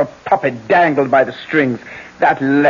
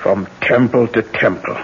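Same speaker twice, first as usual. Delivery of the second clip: ordinary delivery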